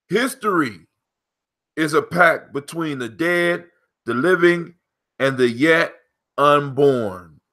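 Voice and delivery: slow, measured proverb reading with pauses; proverb recital. Acted. Host reads the proverb to his co-host, measured recital. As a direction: solemnly recite it, phrase by phrase.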